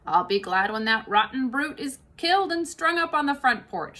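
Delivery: big kitchen woman grumbling about the fox; Mabel voice. Acted. Mabel the cook's voice.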